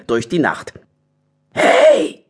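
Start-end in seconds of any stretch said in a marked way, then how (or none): 1.5-2.3 high, loud call. Acted. Harry the badger calls to a passer-by, high shout. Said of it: "rief Harry Dax".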